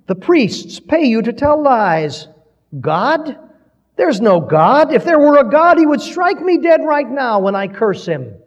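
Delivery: high, loud, cutting jeer; cutting cry. A narrator's reading. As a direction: hurl taunts at the crowd like blades. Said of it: "In a cutting voice, he cried out".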